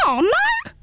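very high squealing hedgehog. Acted. Hedgehog squeals at Pins, very high indignant squeal. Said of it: "squealed the hedgehog".

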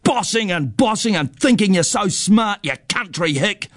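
high-pitched taunt, falling at the end; boy's heated jeering, acted; angry yell. A narrator's reading. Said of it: "he yells".

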